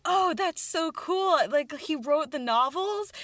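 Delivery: high, slow, drawn-out admiration; impressed fan. Retold or imitated. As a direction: coo it, missing the point. Fans misunderstand the reveal.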